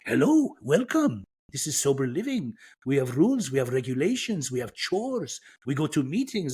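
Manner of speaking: put-on a light Persian accent like a small wise Yoda. Greeting at sober living door in Persian accent. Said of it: "Kinda sounded like Yoda with a, with a Persian accent".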